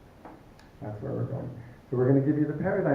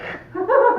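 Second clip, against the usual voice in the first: a sudden high laugh bursting out; high bright burst of laughter; 9 dB louder than usual. Real, not acted. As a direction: laugh out loud, surprised. Laughs at partner's reading.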